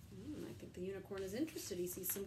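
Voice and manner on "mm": ordinary delivery, nothing marked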